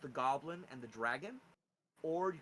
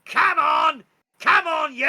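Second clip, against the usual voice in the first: high, full-force goblin shout; screeched goblin command; goblin yelling; 23 dB louder, slower than usual. Acted. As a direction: yell orders while yanking a chained beast. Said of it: "he tugs it and goes".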